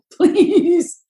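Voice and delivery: a giggling, lifted echo of the plea; laughing plea. Retold or imitated. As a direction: laugh out the please. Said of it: "He's even said".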